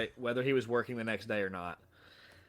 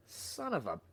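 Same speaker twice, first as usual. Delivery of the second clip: amazed realisation; 6 semitones higher than usual. Retold or imitated. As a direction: act out a sudden happy revelation. Acting out his first-commission revelation.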